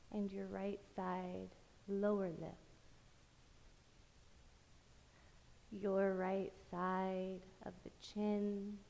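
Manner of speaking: slow, soft meditation voice with long pauses; guided meditation. Acted. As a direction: lull them through a body scan, phrase by unhurried phrase. Leads guided body-scan meditation.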